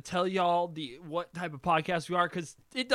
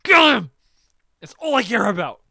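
strained, high, loud outburst; enraged; 3 semitones higher, 10 dB louder than usual. Retold or imitated. Fan urging his hero on against Neji, enraged. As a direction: explode after holding it in.

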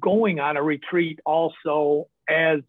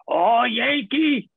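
slow, higher, stretched "Oh, Yankee"; drawn-out Yankee greeting; 7 semitones higher, much slower than usual. Retold or imitated. Old Texan boss greets the northern painter, slow and high. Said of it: "And he's like".

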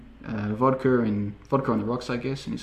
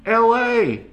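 high, loud shout-out; called-out suggestion; 12 semitones higher, 6 dB louder than usual. Acted. Someone answers the president's question, calling out high and loud. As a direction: pipe up loudly from across the room.

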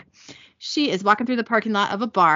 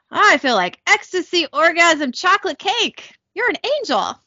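joyful rapture; 8 semitones higher than usual. Pretend. Babysitter blissed out by Amara's touch, ecstatic gush. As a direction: swoon with delight. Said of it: "starts joyfully laughing".

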